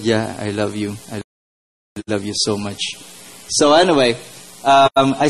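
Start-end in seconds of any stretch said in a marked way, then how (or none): 0.0-2.8 soft, quiet, gentle God's voice; tender reply. Retold or imitated. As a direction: say it softly, like a loving whisper of reassurance. God answers tenderly in retelling.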